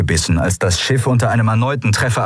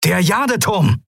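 called out; 11 semitones higher than usual. A narrator's reading. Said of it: "rief Quailor".

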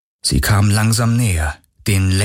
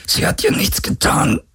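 much higher, breathless and pained; strained plea. Acted. Galen pleads for Kirai, breathless and strained. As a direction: gasp it out against the pain. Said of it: "brachte Galen hervor".

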